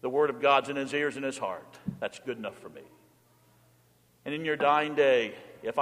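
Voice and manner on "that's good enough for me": normal talking voice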